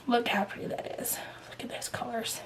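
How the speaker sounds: soft admiring whisper. Real, not acted. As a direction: breathe it out, marvelling at the colors. Admiring thread colors on camera.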